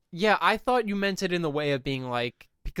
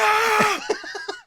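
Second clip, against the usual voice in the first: high-pitched acted scream; demonstrated scream. Shown as a demonstration. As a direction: let out a sudden high scream. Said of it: "I just scream and then".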